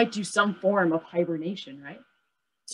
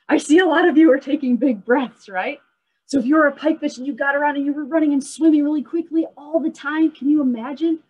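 high, louder, lively; excited; 8 semitones higher, 5 dB louder than usual. Real, not acted. Teacher to panting children after the game, excited. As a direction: keep the energy from the game. Kids back, out of breath.

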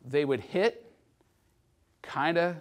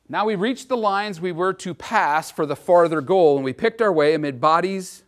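louder, measured reading of a soldier's journal; 2 semitones higher, 5 dB louder than usual. Acted. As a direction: read the old journal to the class with weight. Reads soldier's journal aloud.

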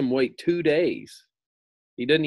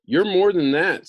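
loud, higher accusing cry; accusing Scarecrow; 8 semitones higher, 8 dB louder than usual. A narrator's reading. Scarecrow calling out the fake wizard, loud and higher. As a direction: call him out.

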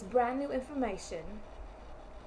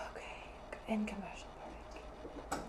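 very soft, low whisper; whispered sign-off; 3 semitones lower, 12 dB quieter than usual. Real, not acted. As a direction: whisper it under your breath. First sign-off, under the breath.

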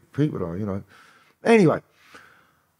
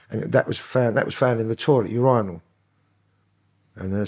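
usual speaking voice

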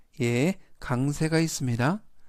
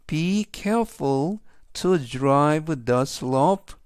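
very slow, careful English sentence; slow model sentence; much slower than usual. Shown as a demonstration. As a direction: read each word slowly for students. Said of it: "전체적인 의미는". (학생들을 위해 아주 천천히 읽는 예문)

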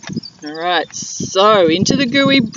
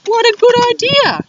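delighted dingo; 12 semitones higher, 4 dB louder than usual. Acted. Dingo delighted by mud idea.

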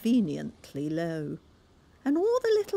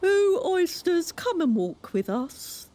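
beseeching walrus; 12 semitones higher, 5 dB louder than usual. A narrator's reading. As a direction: coax the oysters sweetly. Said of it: "the walrus did beseech".

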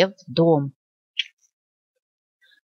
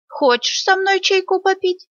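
put-on high, squeaky old wolf asking kindly; 13 semitones higher than usual. Волк приглашает ежика на чай. (высокий голос старого волка)

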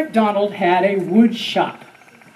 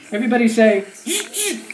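usual speaking voice

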